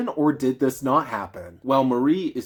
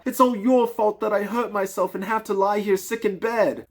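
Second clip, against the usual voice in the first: put-on much higher young girl's voice, pleading and reproachful; girl crying out; 8 semitones higher than usual. Marie cries out at her godfather, a high girl's voice. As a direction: play a hurt little girl scolding her godfather. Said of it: "she cried out".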